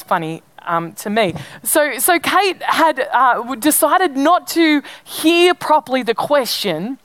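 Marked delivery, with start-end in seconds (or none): none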